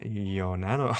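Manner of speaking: low, soft echo of a creepy TTS voice; creepy TTS imitation. Streamer copying the creepy donation alert, low TTS imitation. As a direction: quietly mimic the odd text-to-speech delivery. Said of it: "say it differently that time".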